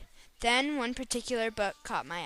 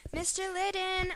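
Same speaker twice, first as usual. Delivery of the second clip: calling out; 8 semitones higher than usual. A narrator's reading. Girl calls the librarian at the desk, calling out higher. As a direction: call his name across the shop, pitched up. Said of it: "I called".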